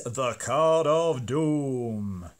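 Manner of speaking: slow, booming game show announcer. Pretend. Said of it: "Best game show voice there".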